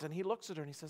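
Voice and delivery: ordinary talk, nothing marked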